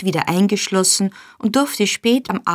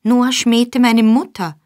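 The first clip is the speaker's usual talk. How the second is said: slow, loud, pressed defiance; defiant; 5 semitones higher, 4 dB louder than usual. A narrator's reading. Boy defying his master, slow and loud. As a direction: spit it back slowly and hard. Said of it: "erwiderte Oliver trotzig".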